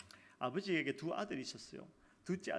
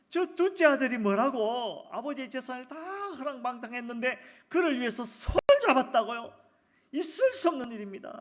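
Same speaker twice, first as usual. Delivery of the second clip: outraged disbelief; 6 semitones higher, 10 dB louder than usual. Acted. Elder son outraged at his father over the feast, loud. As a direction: spit out the injustice, loud and high. Elder son's outburst continues.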